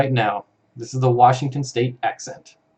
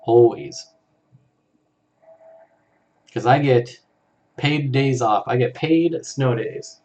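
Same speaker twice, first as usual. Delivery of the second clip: ordinary delivery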